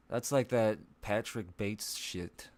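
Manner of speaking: usual speaking voice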